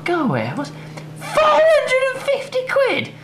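very high, slow, disbelieving shout; outraged surprise. Real, not acted. Vlogger sees the chair's price, shrieking in disbelief.

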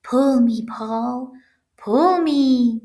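high, drawn-out coaxing little voice; little pigtail voice. A narrator's reading. Pigtail tempting the boy to pull, coaxing. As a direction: beckon in a small, high voice. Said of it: "a little voice coming from the pigtail".

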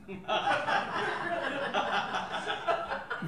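a short, amused laugh; soft laugh. Real, not acted. Narrator laughing at endangered-only-in-New-Jersey, light chuckle. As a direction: laugh at the irony of it. Amused by her own remark.

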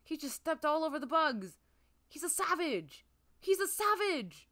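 loud, high-pitched amazed exclamation; excited outburst. Real, not acted. Player reacting to a cute villager stomping bugs, excited. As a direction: exclaim it in high, rising disbelief.

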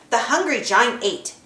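ordinary delivery

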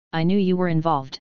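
words muffled by a mouthful of coffee. A narrator's reading. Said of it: "he said in a muffled voice".

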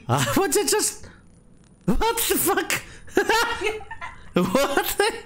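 high-pitched laughing disbelief; shocked, voice shooting up high; startled. Real, not acted. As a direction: squeal it through startled laughter. Startled by the game's scream.

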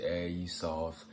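normal talking voice

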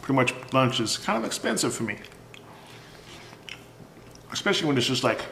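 food near mouth; speaking between bites; talking while chewing. Real, not acted. Seen 0: talks holding bitten food, bites again near 1.8; not fries.

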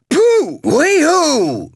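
high, loud drawn-out whooping; exultant whoop. Acted. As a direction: whoop with wild glee.

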